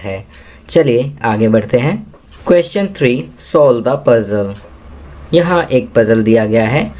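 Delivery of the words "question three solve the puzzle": very slow, deliberate classroom reading; teacher's slow read-aloud; slow instruction reading. Shown as a demonstration. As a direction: read each English word slowly for young learners. Teacher reads question.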